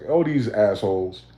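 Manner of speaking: ordinary talk, nothing marked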